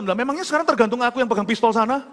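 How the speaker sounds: high, pleading retold complaint; protesting to God. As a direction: argue back at God in protest. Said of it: "saya tak protes sama Tuhan".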